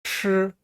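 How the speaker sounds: 吃 with the tongue curled back; curled-tongue sound. Shown as a demonstration. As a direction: model 吃 as a sustained retroflex syllable. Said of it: "저를 고부리고 발음합니다". (吃를 혀를 구부려 길게 발음)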